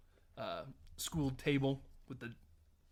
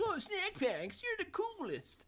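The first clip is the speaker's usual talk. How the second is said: put-on much higher, slow childish gushing; excited kid voice; 3 dB quieter, slower than usual. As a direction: squeal with delight like a kid. Billy gushing over snacks.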